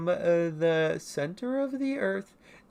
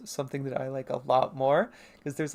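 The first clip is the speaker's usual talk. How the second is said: usual speaking voice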